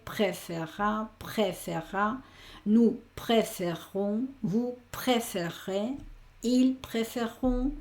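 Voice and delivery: "préférera", "préférons", "préférez" in French, spoken slowly, each syllable laid out; teacher spelling out French sounds; slow French conjugation. Shown as a demonstration. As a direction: drill the French forms slowly for a learner. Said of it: "cómo se pronuncia cada una de las sílabas".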